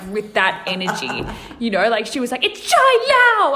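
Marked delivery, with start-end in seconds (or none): 2.4-3.5 much higher, louder, slow "Shai Lao" in a cartoon accent; over-the-top Mandarin accent. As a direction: shout the name like a cartoon show-off. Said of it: "saying it like Samurai Pizza Cats".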